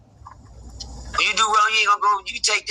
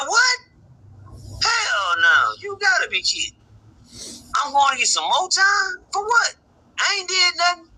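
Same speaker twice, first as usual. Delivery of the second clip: high, pleading mock disbelief; cracking incredulous retold cry; shocked protest; 9 semitones higher than usual. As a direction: replay your own stunned outrage, voice jumping up.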